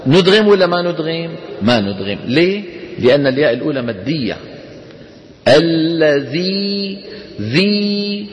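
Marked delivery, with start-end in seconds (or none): 5.5-7.0 الذي with the ي held long; stretched madd. Shown as a demonstration. Teacher showing the class the long vowel. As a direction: draw out the ي in الذي. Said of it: "شايفين المد؟".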